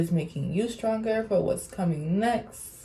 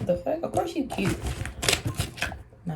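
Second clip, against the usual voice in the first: usual speaking voice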